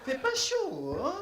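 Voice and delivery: a hint of a prissy Frenchman; prim French voice. Now a proper Frenchman complaining about the cold. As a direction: mince the words politely. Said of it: "je dis".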